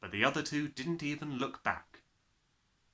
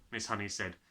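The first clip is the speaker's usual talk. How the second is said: usual speaking voice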